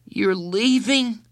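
put-on very high, drawn-out child's wail; upset disbelief. A narrator's reading. Boy repeats the news of her leaving, distressed disbelief. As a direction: stretch it slowly, high and stunned.

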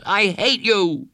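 put-on high, loud, drawn-out child's yell; angry yell. A narrator's reading. Boy yells at counselor through the door, furious. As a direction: yell it slowly at high pitch. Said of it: "he yelled".

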